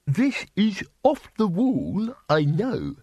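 the bursar, brightly pleased with his notion. A narrator's reading. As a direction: announce it with dotty delight. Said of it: "said the bursar brightly".

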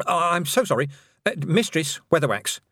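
high, apologetic fluster; flustered witch. Acted. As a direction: scramble to say sorry. Miss Tick apologising.

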